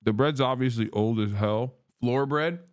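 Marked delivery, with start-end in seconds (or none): none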